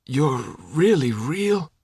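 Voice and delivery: slow, weak, disbelieving croak; rasping in disbelief, slowly. Acted. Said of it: "the guy croaked again".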